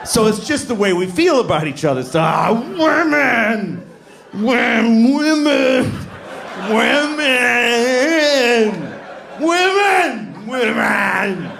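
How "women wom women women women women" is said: exasperated cry. Acted. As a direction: throw up your hands and wail it.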